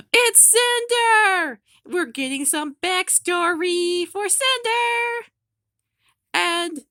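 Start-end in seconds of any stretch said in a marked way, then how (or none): 0.0-1.6 high, loud and drawn-out cry of joy; excited shout. Real, not acted. Fan shouting her favourite villain's name, thrilled. Excited reveal of character backstory.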